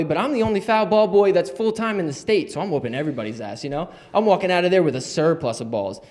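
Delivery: usual speaking voice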